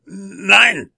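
high, loud dwarf's cry of refusal; shrieked dwarf protest; cursing dwarf. A narrator's reading. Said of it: "fluchte der Zwerg".

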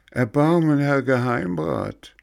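rising desperate plea; pleading. A narrator's reading. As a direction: beg for mercy, desperate. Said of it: "weinte und flehte".

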